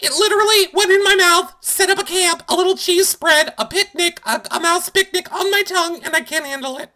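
high, fast squealing complaint; mock hysterical. Podcaster mocking castmate's rat story, shrill high squeal. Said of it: "She was like".

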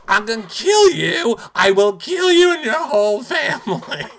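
high, loud, hammed-up threat with a laugh; mock murderous threat. Imitating Missy threatening Elaine after the vote. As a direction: ham up a screeching death threat. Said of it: "She's like".

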